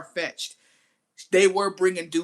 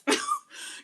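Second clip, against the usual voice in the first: short high squealing laugh; quick high giggle. Real, not acted. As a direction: crack up. Laughing at own joke.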